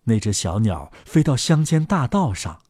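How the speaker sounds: plain reading voice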